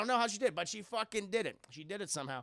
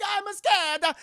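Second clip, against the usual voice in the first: put-on high, loud whiny scared child; mock scared kid; 12 semitones higher, 12 dB louder, slower than usual. Little Red Skull strapped in the chair, mock scared. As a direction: squeak it like a frightened boy. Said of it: "He's very scared".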